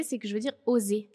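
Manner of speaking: ordinary talk, nothing marked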